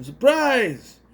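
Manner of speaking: high, loud party yell; mock-excited burst; acted surprise shout. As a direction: call it out like jumping out at a party.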